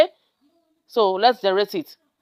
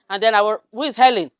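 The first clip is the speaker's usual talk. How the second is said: ordinary talk, nothing marked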